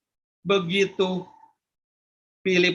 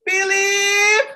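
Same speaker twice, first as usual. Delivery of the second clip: mother calling; 11 semitones higher, 5 dB louder than usual. Acted. Mother calls.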